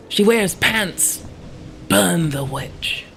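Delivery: slow, loud accusing mob cry; angry mob leader. Pretend. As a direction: drag out the accusation to the crowd. Mob chant continues.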